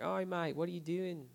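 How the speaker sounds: high mock Aussie "Oi, mate"; Australian bloke. Australian stranger on the patio asks crying man what's up, mock Aussie. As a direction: do the tattooed Australian calling out. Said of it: "big Australian guy ... was like".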